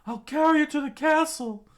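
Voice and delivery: very high, loud strained yell; Usopp screaming. Acted. Usopp hauling a man uphill, screamed. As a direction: holler the promise with all your might. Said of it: "He screamed out".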